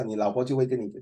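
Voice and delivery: ordinary delivery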